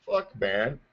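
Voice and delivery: usual speaking voice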